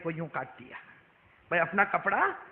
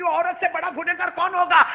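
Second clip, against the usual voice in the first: high, loud astonished question; loud incredulous cry; shocked question; 9 semitones higher, 10 dB louder than usual. Retold or imitated. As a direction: demand to know who could be worse, voice raised. Acts shocked question to Prophet.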